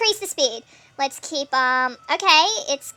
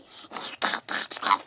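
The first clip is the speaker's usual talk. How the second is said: slow, guttural demon croak; 7 dB quieter, much slower than usual. Pretend. Player taunting in the game, in a throaty demon voice. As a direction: growl it slowly from the throat like a demon. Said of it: "doing that demon voice".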